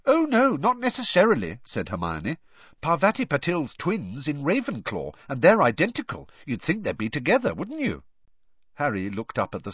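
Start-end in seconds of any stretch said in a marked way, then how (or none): 0.1-1.6 put-on high, brisk knowing girl voice; know-it-all girl. Hermione correcting Ron. 2.8-8.0 lightly voiced know-it-all girl. Hermione explaining.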